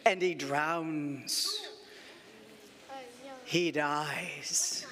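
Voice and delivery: low, drawn-out solemn narration; slow, grave. A narrator's reading. Storyteller announcing the lion's death, slow and grave.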